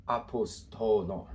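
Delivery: "Apostolon" slowly, syllable by syllable; careful Greek pronunciation. Shown as a demonstration. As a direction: sound out "Apostolon" for learners of Greek. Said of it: "we'll pronounce these".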